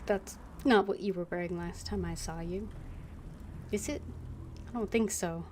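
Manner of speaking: ordinary delivery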